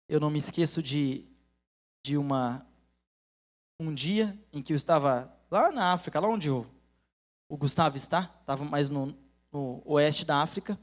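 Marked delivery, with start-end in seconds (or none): none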